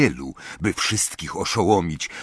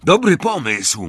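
plain reading voice

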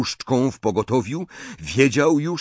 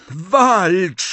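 high, loud single cry; shouted challenge; 8 semitones higher, 5 dB louder than usual. A narrator's reading. Harry challenging Snape to fight, shouting. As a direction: call out a demand. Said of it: "zawołał Harry".

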